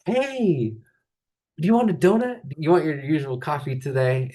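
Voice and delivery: sweet high donut shop server; caring shop lady. Retold or imitated. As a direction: coo over a regular customer. Caring donut shop ladies voiced.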